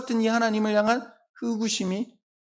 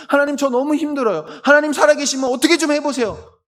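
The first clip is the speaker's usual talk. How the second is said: high, loud desperate plea to God; raised voice, pleading urgently with God; loud lament; 8 semitones higher, 6 dB louder than usual. Acted. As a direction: cry out in acted desperation. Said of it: "탄식할 때도 있고".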